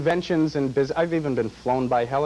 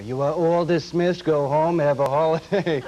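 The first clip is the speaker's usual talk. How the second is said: loud, high, drawn-out dismissal announcement; school principal impression; 4 semitones higher, 5 dB louder, slower than usual. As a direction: proclaim it like a principal on the PA. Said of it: "dismissing the school as my principal".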